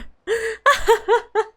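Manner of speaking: high, theatrical villain laugh; villain's cackle. Acted. Villain laughs at human hope, mocking cackle.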